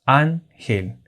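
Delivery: "ángel" as two separate beats, án, gel; split into syllables. Shown as a demonstration. Teacher counting two syllables, "ángel" divided into án and gel. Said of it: "La palabra ángel tiene dos sílabas".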